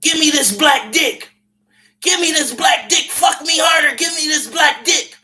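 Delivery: high mock shouts of a woman in bed; mock woman crying out. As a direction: ham up her cries of passion, shouting. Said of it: "she say".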